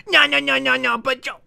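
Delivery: high mocking protest; squeaky caricature of someone arguing back; imitating an objector. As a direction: sputter objections in a high put-on voice. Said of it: "this is how he does it".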